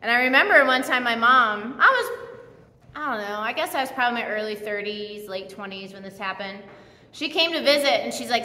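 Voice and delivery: ordinary talk, nothing marked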